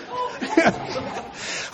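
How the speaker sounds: real airless laugh high up; high breathless laugh. Real, not acted. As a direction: let a high silent laugh escape. Laughing at the pastor's antics.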